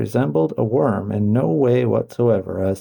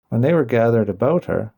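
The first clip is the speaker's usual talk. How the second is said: ordinary delivery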